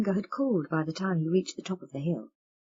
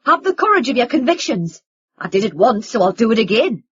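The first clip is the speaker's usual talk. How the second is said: louder, ringing defiant shout; 4 semitones higher, 11 dB louder than usual. Acted. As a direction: let it ring through the kitchen. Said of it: "His voice rang through the kitchen".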